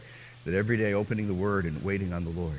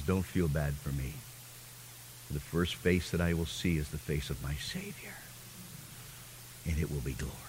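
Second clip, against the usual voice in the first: quiet reply; 7 semitones lower, 10 dB quieter than usual. Retold or imitated. Blind woman's quiet reply, quoted.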